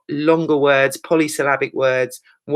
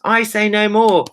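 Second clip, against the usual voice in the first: higher, louder exclamation on every word; raised, insistent outburst; emphatic exclamation; 7 semitones higher, 5 dB louder than usual. Shown as a demonstration. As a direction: exclaim it with a million exclamation marks. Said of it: "a million exclamation marks".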